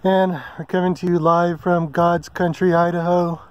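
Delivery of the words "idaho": normal talking voice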